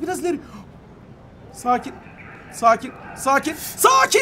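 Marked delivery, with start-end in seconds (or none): 3.8-4.2 urgent, much higher shout; panicked shout. Real, not acted. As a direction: yelp it as control slips. Calm word turns to panic.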